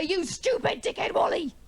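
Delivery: high-pitched mock tirade; acted angry outburst. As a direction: hurl the insult in a high, exasperated voice. Acted confrontation after getting mad.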